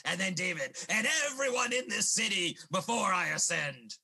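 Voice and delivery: angry villain's threat; growing threat, rising at the end. Acted. Villain threatening the old man and all the city, angry.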